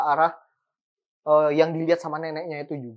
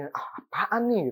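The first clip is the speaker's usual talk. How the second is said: mock panic; 5 semitones higher, slower than usual. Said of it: "Panik kan si nenek ini".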